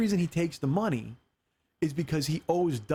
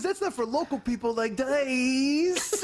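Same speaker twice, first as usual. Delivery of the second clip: failed Dice voice; 6 semitones higher, slower than usual. Retold or imitated. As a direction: try the voice and crack up. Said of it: "I can't even do the voice".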